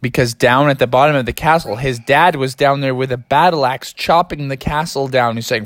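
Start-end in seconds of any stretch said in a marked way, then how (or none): none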